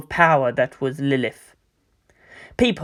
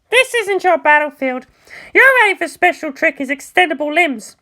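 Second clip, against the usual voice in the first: put-on higher, cocky, blunt girl voice; brash pilot Asuka; 12 semitones higher than usual. Asuka telling Mayumi it's not her battlefield, higher brash voice. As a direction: voice Asuka high and sure of herself, talking down. Asuka lectures over comm.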